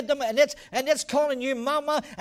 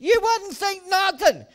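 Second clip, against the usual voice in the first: higher, louder incredulous exclamation; exclaiming; 7 semitones higher, 5 dB louder, slower than usual. Real, not acted. Pastor scoffing at dithering, high and loud. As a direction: blurt it out in disbelief.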